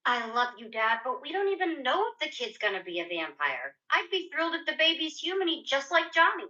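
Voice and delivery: high, fast put-on character voice; cartoon character voice. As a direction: play a chirpy cartoon kid. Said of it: "Do a voice".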